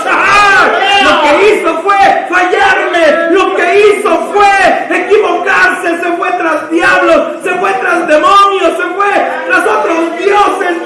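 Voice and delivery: roaring, impassioned preacher's cry; anguished divine outburst. Acted. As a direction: build to a full high-pitched shout. Preacher voices God's anguished outburst.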